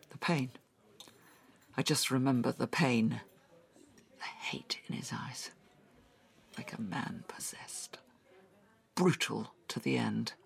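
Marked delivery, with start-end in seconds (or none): 4.2-8.0 whispered, haunted by the memory; hushed whisper. Acted. Tash telling Sven about her attacker, whispering. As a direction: breathe it out, barely voiced.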